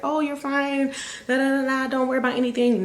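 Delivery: high-pitched mock reassurance; singsong, high brush-off; dismissive reassurance. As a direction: imitate staff breezily brushing off a worry, pitched well up. Mimicking staff brushing off her concern.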